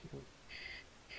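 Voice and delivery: 슈 whispered, just a hissing sh; isolated sh. Shown as a demonstration. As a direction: isolate the 슈 sound unvoiced. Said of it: "이 발음이죠".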